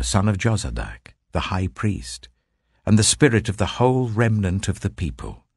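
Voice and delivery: normal talking voice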